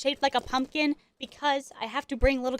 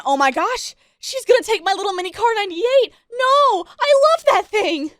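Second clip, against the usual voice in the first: much higher and louder, slowing on the last words; panicked inner voice; 10 semitones higher, 8 dB louder than usual. Retold or imitated. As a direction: wail it like your favorite toy is being taken. Panicking that keychain gets taken.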